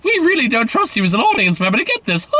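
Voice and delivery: high-pitched, patronizing parody voice; hammed-up mockery of a condescending film; mock over-explaining. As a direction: spell out the joke in a silly high voice. Mocking trailer over-explaining its joke.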